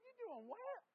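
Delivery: very high-pitched, baffled question; mock incredulous. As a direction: squeal it in exaggerated disbelief, rising at the end. Acting baffled reaction to cousins' slang.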